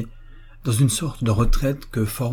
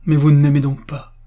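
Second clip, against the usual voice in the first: higher, louder wounded challenge; distressed reproach; 5 semitones higher, 7 dB louder than usual. Acted. Fiancée challenging the officer's love, distressed reproach. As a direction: throw the question at him.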